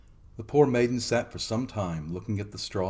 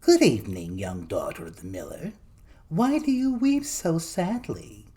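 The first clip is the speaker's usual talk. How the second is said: sly gnome voice, slowing on the last words; little man voice; 7 semitones higher than usual. A narrator's reading. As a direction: draw out the question like a creepy imp. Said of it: "who said".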